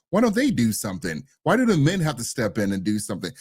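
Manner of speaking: ordinary talk, nothing marked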